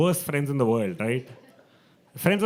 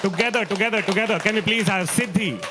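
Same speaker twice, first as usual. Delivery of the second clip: loud, high rallying call; calling out to crowd; 8 semitones higher, 6 dB louder than usual. Real, not acted. Host hyping up the audience with a loud call. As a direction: rev the crowd up for the next act.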